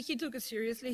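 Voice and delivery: ordinary talk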